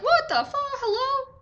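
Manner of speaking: very high-pitched disbelief; incredulous shock. Retold or imitated. Patient overhearing radio sermon at clinic, shocked and incredulous. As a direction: squeal it in mock astonishment.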